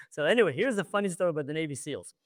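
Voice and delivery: ordinary talk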